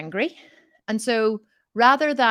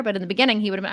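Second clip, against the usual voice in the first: ordinary delivery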